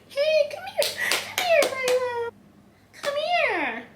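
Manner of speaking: sing-song high call to coax a puppy; high-pitched voice. Shown as a demonstration. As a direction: owner demonstrates a high call to the puppy, very high and bright. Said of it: "a high-pitched voice".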